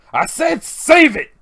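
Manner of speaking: loud, high, drawn-out command; exasperated yell. Acted. Breaking up a fight for the second time, exasperated yell. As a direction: yell it slowly to shut down the fight. Acted order to stop fighting.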